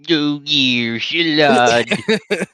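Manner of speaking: exaggerated droopy character impression. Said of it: "like droopy or something".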